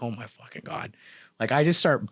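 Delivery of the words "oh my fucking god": much softer, almost breathed out; stunned under-breath reaction. Retold or imitated. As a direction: mutter it, overwhelmed by the scene. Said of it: "And I'm like".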